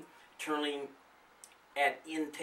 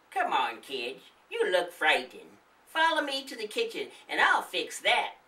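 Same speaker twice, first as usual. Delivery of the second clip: put-on kindly old woman; 4 semitones higher, 4 dB louder than usual. Old woman soothing kids.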